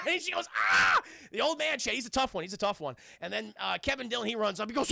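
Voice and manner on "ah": loud yell of impact; hammed-up cry of pain; mock scream. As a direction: yell as if struck by a car. Acting old man hit by car.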